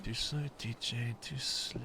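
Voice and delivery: soft breathy whispered tease; whispered taunt. Acted. Taunting friend again.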